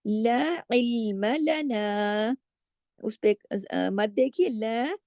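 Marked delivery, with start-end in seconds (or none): 0.1-2.4 ला held long before इल्म लना, slow; lengthened madd. Shown as a demonstration. Teacher demonstrates the madd to students, slow and drawn. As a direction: stretch the ला slowly. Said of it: "उसको खींच के पढ़ेंगे मत की वजह से".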